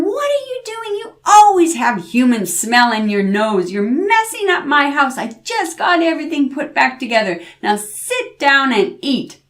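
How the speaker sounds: high, sharp scolding; scolding grandmother. A narrator's reading. Grandmother scolding the devil for messing her house. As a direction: nag him to sit and eat.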